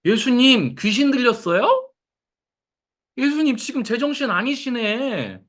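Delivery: high, incredulous scolding; mock rebuke. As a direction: tell him he's lost his mind. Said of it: "이렇게 한 거예요".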